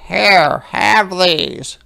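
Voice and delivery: a small creature deciding bravely; little hedgehog voice. Acted. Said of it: "said Little Hedgehog".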